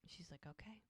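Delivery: hushed whispered okay; soft whispered reply. Retold or imitated. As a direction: whisper it so no one hears. Whispered aside between characters.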